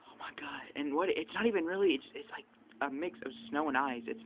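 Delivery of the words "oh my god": breathless awed whisper. Real, not acted. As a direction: whisper it under your breath. Freezing in the snowstorm.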